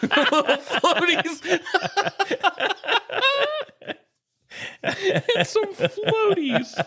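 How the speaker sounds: high giggling through the words; can barely get it out for laughing; squeaky helpless laughter, slow; laughing hard. Real, not acted. Cracking up over floaties joke.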